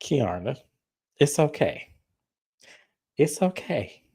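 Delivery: soft mock reassurance; mock consoling. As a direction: soothe her like a friend. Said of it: "I'm looking at her like".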